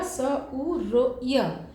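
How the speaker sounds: each sound of स उ र य said apart, very slowly. Shown as a demonstration. Teacher slowly splitting Surya into sounds for students. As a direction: spell out स उ र य sound by sound for the class. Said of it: "अलग-अलग से इसको slowly बोल के देखें".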